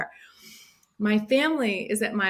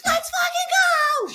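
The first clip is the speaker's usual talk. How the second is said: very high, loud victory scream; in-your-face yell; 5 dB louder than usual. Retold or imitated. Runner to the lady handing out medals, triumphant yell. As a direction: scream it right in someone's face. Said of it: "in the lady's face".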